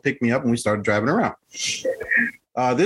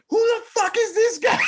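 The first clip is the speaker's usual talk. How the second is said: much higher, hammed-up yell of the girlfriend; mock furious shout. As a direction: belt it out high as the outraged girlfriend.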